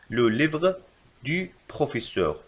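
slow, in two chunks; slow dictation. Shown as a demonstration. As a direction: dictate the correction slowly. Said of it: "يجب كتابة".

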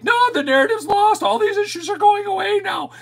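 high squeaky caricature of gloating pundits; squeaky mock voice. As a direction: parody their smug certainty in a squeaky, over-the-top voice. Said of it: "Everybody's like".

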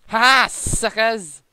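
loud, slow gloating laugh. Acted. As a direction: crow over them. Gloating at beaten enemies.

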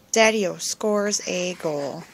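usual speaking voice

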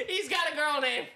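mock a friend while cracking up; laughing tease. Real, not acted. Teasing after laughing fit.

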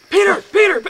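high, loud shout of his name; calling for help. Retold or imitated. Hurt man shouting for his roommate, loud call. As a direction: yell for your roommate in pain. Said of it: "I'm like".

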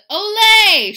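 very loud, high, held cheer; shout. A narrator's reading. Amigos greet the landed daredevil, shouting high. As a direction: belt it out like a crowd. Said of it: "shouted his amigos".

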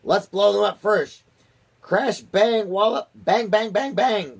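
high, loud battle cry. A narrator's reading. Army chief urging an attack on the capsule, loud excited cry. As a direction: shout the bangs out high and hard. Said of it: "cried the chief of the army".

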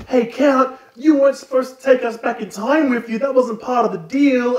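put-on high, loud whiny kid voice; indignant children calling out the count, high and loud; kids complaining. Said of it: "the kids are like".

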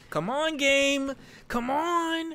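high, drawn-out plea; straining high-pitched begging; pleading. Real, not acted. Pleading with the game.

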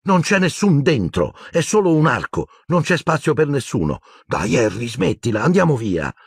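high, impatient brush-off; high-pitched annoyed insistence; irritated. A narrator's reading. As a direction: shut it down, exasperated. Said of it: "in tono più irritato del necessario".

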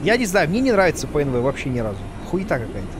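normal talking voice

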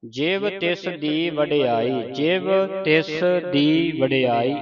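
ਜਿਵ ਤਿਸ ਦੀ with each word set apart, not run together; words kept apart. Shown as a demonstration. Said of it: "ਇਹ ਵੱਖਰੇ ਵੱਖਰੇ ਪਾਠ ਨੇ".

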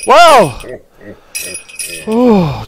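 normal talking voice

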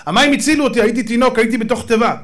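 high, loud pleading of one's case; acted protest. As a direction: argue back as an indignant Moses. Acts Moses protesting.

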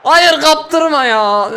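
slow, stretched-out yell; drawn-out shout. Real, not acted. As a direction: bellow at the screen. Yelling at player losing ball. (uzatarak bağırma)

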